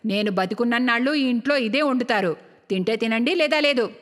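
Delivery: low start, firm scolding elder; stingy mother. Acted. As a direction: lay down the law to your sons. Stingy mother scolds her sons. (కొడుకుల్ని మందలించే పిసినారి తల్లి)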